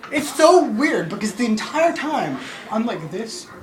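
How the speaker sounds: ordinary talk, nothing marked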